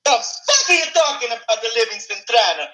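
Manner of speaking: Mario voice. Acted. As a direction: squeak out a confused cartoon plumber. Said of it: "goddamn Italian plumber".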